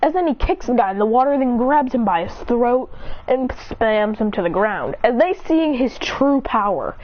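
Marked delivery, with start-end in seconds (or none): none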